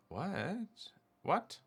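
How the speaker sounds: real shock climbing into a high squeal; incredulous surprise. Real, not acted. Streamer shocked by game result.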